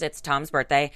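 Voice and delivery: usual speaking voice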